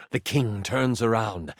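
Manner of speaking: ordinary talk, nothing marked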